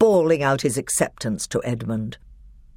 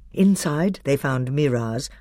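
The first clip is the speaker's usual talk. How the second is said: ordinary delivery, nothing marked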